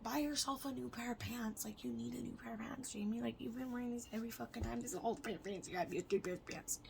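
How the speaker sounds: soft, partly whispered pep talk to herself; telling herself quietly to buy pants; whispered self-talk. Retold or imitated. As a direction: whisper it to yourself, coaxing. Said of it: "I told myself".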